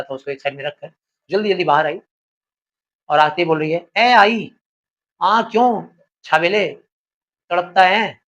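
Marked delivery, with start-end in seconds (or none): 4.0-8.2 a touch of Punjabi in a loud retort. Acted. Wife answering her shouting husband with a touch of Punjabi. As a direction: snap back lightly Punjabi-accented. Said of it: "ये थोड़ा Punjabi tone में बोला गया है".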